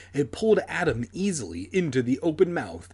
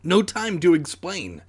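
higher, urgent reply; mild narrated choke; choked out; 5 semitones higher, 4 dB louder than usual. A narrator's reading. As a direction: force the words out in a hurry. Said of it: "I choked out".

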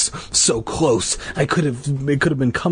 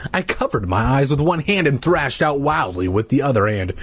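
usual speaking voice

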